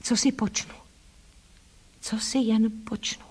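hushed, despairing whisper; lost girl whispering to herself. A narrator's reading. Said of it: "šeptem opakovala".

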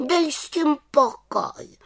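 very high, drawn-out cross voice; grumpy little witch. A narrator's reading. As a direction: brush it off crossly as a small witch. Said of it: "vykřikla mrzutě". (mrzutě, vysokým hláskem)

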